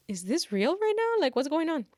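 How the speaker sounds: a touch of incredulous surprise; disbelief. Retold or imitated. Student in the back row, mildly incredulous. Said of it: "looking at everyone like".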